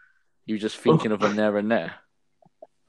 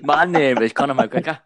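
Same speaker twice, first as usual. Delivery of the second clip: laughing Conor McGregor; 4 semitones higher, 5 dB louder than usual. Retold or imitated. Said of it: "builds it up".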